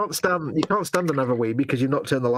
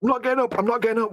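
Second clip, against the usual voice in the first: stubborn refusal; 10 semitones higher, faster than usual. Retold or imitated. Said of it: "retold refusal".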